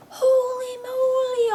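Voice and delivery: high, loud, drawn-out amazement; awestruck exclamation. Acted. Friend amazed by the spark of electricity, awestruck. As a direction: cry it out in stretched, high-pitched awe.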